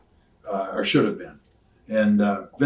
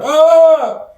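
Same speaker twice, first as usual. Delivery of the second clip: very high, loud sustained scream; wordless yell; 17 dB louder than usual. Shown as a demonstration. Wrestler showing his switch from talking, wordless yell. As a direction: let out one long high "Aaah!". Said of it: "from being able to talk to going".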